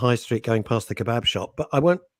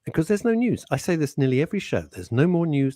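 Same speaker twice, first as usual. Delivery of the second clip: ordinary talk, nothing marked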